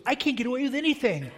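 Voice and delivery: put-on high, loud whiny kid voice; child complaining. Father quoting his son's whiny loud complaint. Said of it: "he said to us".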